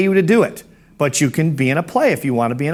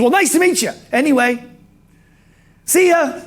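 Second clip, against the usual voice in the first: very high, chirpy goodbye; John Spanath; 10 semitones higher than usual. Retold or imitated. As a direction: sing out a relentlessly cheery farewell. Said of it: "really cheery".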